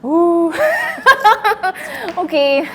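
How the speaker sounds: loud high whoop breaking into laughter; nervous laughter. Real, not acted. Performer trying to calm down, nervous laughter. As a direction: let panic burst out as high giggles.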